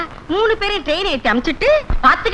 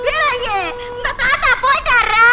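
high, hammed-up crying; wailing grief; 5 semitones higher than usual. Mother cries to child about grandfather's death, high wailing. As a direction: wail it out to the child.

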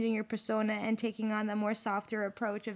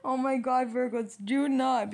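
pleading through leftover laughter; amused scolding, slowing on "do not"; laughing; 3 semitones higher, 4 dB louder than usual. Real, not acted. Reader begging Virgos not to fight, laughing. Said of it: "I'm not laughing like that".

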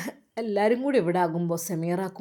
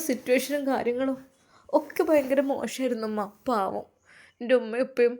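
tearful; 5 semitones higher than usual. Acted. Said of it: "മോളെ കരയല്ലേ".

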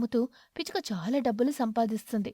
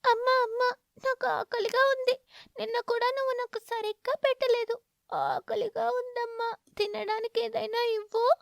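put-on much higher little child's whine; hungry child; 12 semitones higher than usual. Hungry little chick begging its mother for food. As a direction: plead like a small hungry kid.

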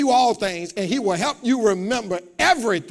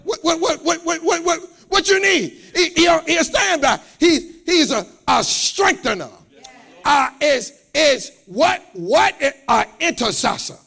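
loud, high, shouting preacher's build; preaching; 7 semitones higher, 5 dB louder than usual. Acted. Preacher shouting to the congregation, full force.

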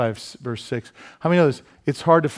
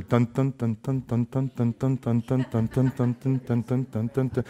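Jaws shark theme; 2 semitones lower, 5 dB quieter than usual. Retold or imitated. Preacher circling the boxer, softly humming shark music. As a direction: hum the shark music as you circle him. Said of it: "I just shark him".

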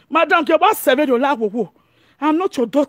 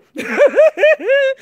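high, pulsing burst of laughter; high cackle; 11 semitones higher than usual. Real, not acted. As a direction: cackle high after your own joke. Laughs after her nonsense sound imitation.

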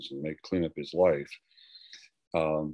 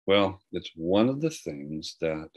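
ordinary delivery

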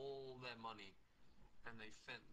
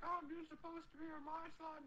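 put-on much higher, whiny girl voice; whiny Anne Frank. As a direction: pout it in a high squeak. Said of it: "And she's like".

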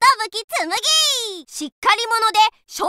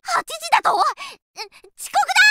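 panicked surprise; 6 semitones higher than usual. Acted. Realizes she is late.

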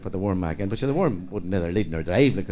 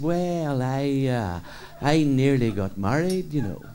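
slow speech; much slower than usual. Acted. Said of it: "Riley spoke very slow".

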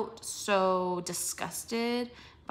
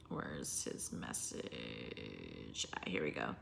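muttering; 15 dB quieter, slower than usual. Real, not acted. Searching messages muttering.

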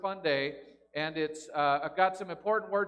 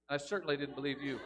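mock doubt; 7 semitones lower, 8 dB quieter than usual. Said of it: "I didn't believe you".

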